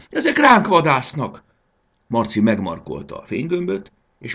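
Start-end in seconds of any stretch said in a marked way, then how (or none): 0.1-1.4 high, loud cry of alarm; screamed warning. A narrator's reading. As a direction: shriek the danger out to him. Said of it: "sikított Fanny". (magas, riadt sikítás)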